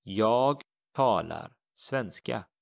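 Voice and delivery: Jag talar svenska, slow and clear; slow teacher demo of the Swedish; slow model sentence. Shown as a demonstration. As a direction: model it slowly for learners. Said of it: "we simply say".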